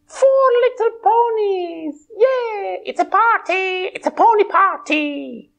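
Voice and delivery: high squealing party cheer; excited pony cheer. Acted. Puppet pony cheering the party, high and excited.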